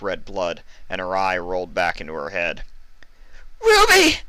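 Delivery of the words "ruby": piercing high scream of her name; screech. Acted. Vinyl as Ruby collapses, screeching very high and loud. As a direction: scream in sudden panic. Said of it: "Vinyl screeched".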